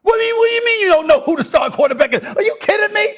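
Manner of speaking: incredulous shouting rant; heated pundit debate. Real, not acted.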